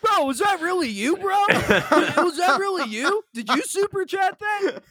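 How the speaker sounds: high, incredulous fan questioning; imitated nosy fan. Fans messaging the host about the chat, imitated incredulity. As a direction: pester him in a high, imitated voice. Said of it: "messages of people asking me".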